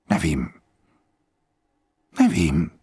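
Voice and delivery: lightly voiced grave general; old general, calm and grave. A narrator's reading. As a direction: speak like a resigned old man at peace.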